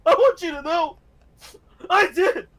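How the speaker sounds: high, loud, crying plea; tearful triumphant hammed-up speech; mock tearful speech. Shark man to the judge, hammed-up tearful speech.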